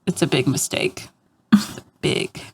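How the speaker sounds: ordinary talk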